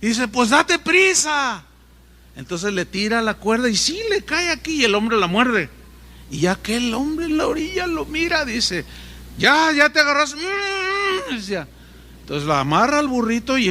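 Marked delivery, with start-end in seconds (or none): 0.3-1.6 impatient plea. Retold or imitated. Injured man urges the rescuer. 9.4-10.3 calling down. Retold or imitated. Rescuer checks the man's grip. 10.3-11.3 muffled hum. Retold or imitated. Man hums with rope in mouth.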